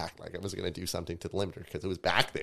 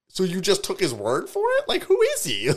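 high, loud retold disbelief; exaggerated high-pitched demand; incredulous challenge; 14 semitones higher, 10 dB louder than usual. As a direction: act out being appalled at them. Acts out challenging the staff.

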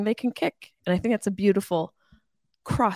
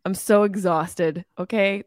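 normal talking voice

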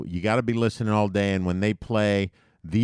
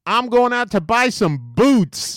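New Yorker; 13 semitones higher, 6 dB louder than usual. Retold or imitated. Typical New Yorker voiced.

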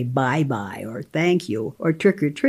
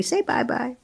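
coaxing parent; 6 semitones higher, 6 dB quieter than usual. Retold or imitated.

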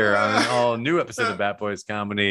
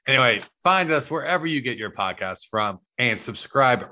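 ordinary delivery

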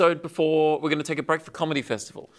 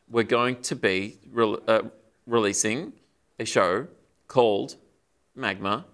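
slow, long pauses between words; staccato, word by word; halting, one word at a time; 3 semitones lower, slower than usual. Real, not acted. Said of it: "one word at a time improv game".

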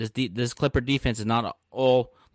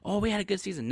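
high, dismissive mimicry; mock contentment; 8 semitones higher, 3 dB quieter than usual. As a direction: mimic easy satisfaction in a raised pitch. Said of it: "They don't care about".

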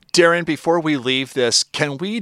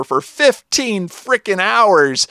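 slow, high and louder, dragged out; exasperated emphasis; 8 semitones higher, 4 dB louder, slower than usual. Real, not acted. Podcaster complaining about the long wait, slow and exasperated. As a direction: lean on the frustration. Mock frustration.

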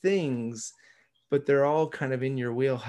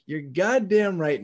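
proud agreement; 5 semitones higher, 5 dB louder than usual. Retold or imitated. Retold agreement.

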